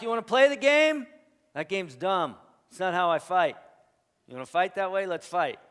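Paul's challenge. Retold or imitated. Preacher voicing Paul daring his rivals, loud and high. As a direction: throw down the gauntlet, loud and high. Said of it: "so Paul's like".

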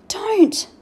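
high, urgent gasp. A narrator's reading. Omri begs his friend not to tell, gasping. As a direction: blurt it out in alarm. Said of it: "Omri gasped".

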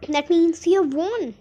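high, loud triumphant announcement; bursting with excitement. A narrator's reading. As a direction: cry out the good news. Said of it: "suddenly exploded with excitement".

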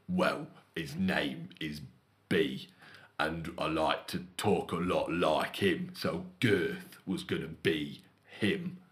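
low, slow put-on deep voice; deep manager voice. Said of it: "one of the managers in store's a really deep voice".

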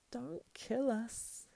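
high, slow creature wail; weeping plea. A narrator's reading. Creature begging Sam not to kill it, tearful. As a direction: plead pitifully in a drawn-out high voice. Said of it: "he wept".

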